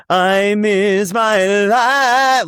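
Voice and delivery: high, slow, showstopper finish; sing-speak it like a big finale; hammed-up showstopper ending; big belted ending. Shown as a demonstration. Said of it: "instead of".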